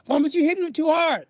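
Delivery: high-pitched, urgent objection; alarmed mother. Retold or imitated. Mother to father beating the boys, alarmed protest. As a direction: imitate a distressed mom protesting.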